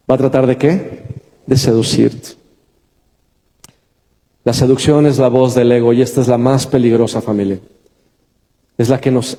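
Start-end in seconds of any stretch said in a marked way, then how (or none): none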